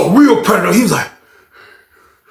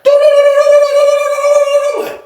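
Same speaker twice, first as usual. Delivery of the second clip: imitated heartbeat; 4 dB louder than usual. Mimicking his pounding heart.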